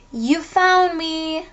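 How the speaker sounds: high, drawn-out playful call; jumping-out call. Acted. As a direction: call it out in a long high singsong. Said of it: "jump out and say".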